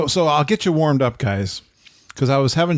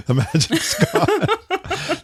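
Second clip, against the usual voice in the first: laughing; much slower than usual. Real, not acted. Laughing echo of a joke.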